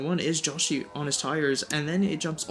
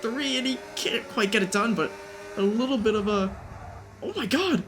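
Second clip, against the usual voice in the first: higher, more excited race call; motorsport commentator; 6 semitones higher than usual. Real, not acted. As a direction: call the crash live, building excitement. Battle and crash called live.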